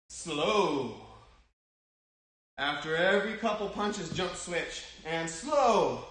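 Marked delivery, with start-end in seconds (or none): 0.1-1.0 long drawn-out "Slow". Shown as a demonstration. Coach cueing kids to punch in slow motion, slow. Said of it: "When I say slow, you're slowing it down". 5.1-6.0 drawn-out "slow". Shown as a demonstration. Coach cueing kids to punch in slow motion, slow. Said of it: "When I say slow, you're slowing it down".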